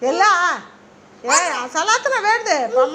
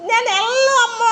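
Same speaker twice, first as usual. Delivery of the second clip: crying plea; 5 semitones higher than usual. Real, not acted. Child refusing, crying.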